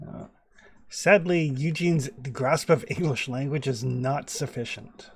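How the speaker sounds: ordinary talk, nothing marked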